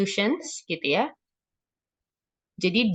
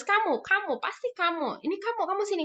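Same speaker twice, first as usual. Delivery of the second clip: high, softer accusing jabs; single out the culprit in a high voice; mock blaming; 12 semitones higher, 4 dB quieter than usual. Punisher pointing out the guilty child, acted accusation. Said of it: "Blaming, menyalahkan".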